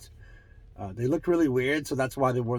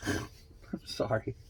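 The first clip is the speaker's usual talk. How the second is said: normal talking voice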